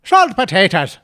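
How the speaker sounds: loud, broad Irish "Salt potatoes", the s turned to sh; hammed-up Irish brogue on "Salt potatoes"; Irish accent. Shown as a demonstration. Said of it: "I'll give you another go".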